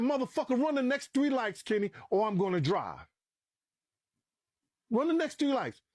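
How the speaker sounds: high-pitched, impatient demand; retold insistent order. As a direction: reenact overruling a balking driver. Retold threat to reluctant driver.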